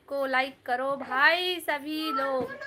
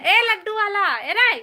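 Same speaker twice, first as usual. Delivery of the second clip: loud, higher-pitched hail; calling out; 5 semitones higher, 7 dB louder than usual. Real, not acted. Hailing the laddu seller, calling out loudly. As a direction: call sharply to get someone's attention.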